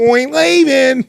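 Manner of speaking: high, drawn-out goofy comic voice; hammed-up slapstick impression; Jerry Lewis. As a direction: stretch it out in a silly high voice. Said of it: "He sounds like Jerry Lewis".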